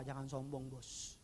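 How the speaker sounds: congregation mumbling it limply; half-hearted quiet repeat; weak, listless echo. Real, not acted. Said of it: "Kok lemes sih ngomongnya sih".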